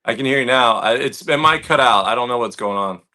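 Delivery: normal talking voice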